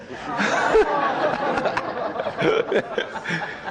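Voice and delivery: high breathy laugh at his own joke; laughing hard at the punchline; big laugh. Real, not acted. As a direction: burst out laughing.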